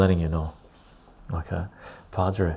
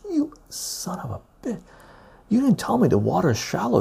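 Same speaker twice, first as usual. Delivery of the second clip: much higher, furious yell at a friend; angry outburst at friend; 11 semitones higher than usual. Retold or imitated. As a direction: blast him for the shallow water. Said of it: "I was very pissed".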